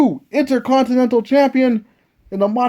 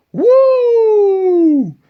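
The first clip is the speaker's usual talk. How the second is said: Flair woo; 6 semitones higher than usual. Acted. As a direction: whoop it out like the Nature Boy. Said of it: "the Nature Boy".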